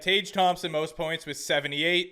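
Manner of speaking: ordinary talk, nothing marked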